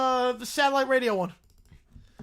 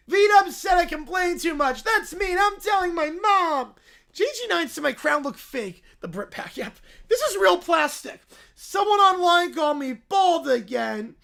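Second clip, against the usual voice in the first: put-on high, louder tattling child; whiny complaining kid; 7 semitones higher, 7 dB louder than usual. Caillou whining on air about mean hosts. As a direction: tattle like a hurt little boy.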